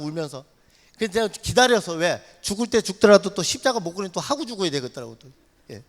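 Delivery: normal talking voice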